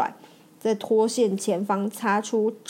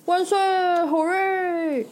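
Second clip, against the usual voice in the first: higher, slow drawn-out hooray; happy cheer; 8 semitones higher, much slower than usual. Acted. Tate brothers cheering after the rescue, happy. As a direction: celebrate with a big cheer. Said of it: "开心欢呼".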